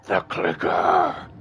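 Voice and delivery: loud, drawn-out guttural growl; monstrous, guttural villain impression; guttural monster. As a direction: growl it slowly like a monstrous villain, rising in force. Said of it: "more, like, guttural".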